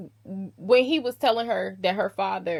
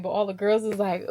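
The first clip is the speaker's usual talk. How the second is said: usual speaking voice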